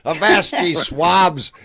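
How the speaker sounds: slow, loud, growled pirate call; gruff old fisherman. Pretend. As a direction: bellow it like an old salt on deck. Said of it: "all them old sailor".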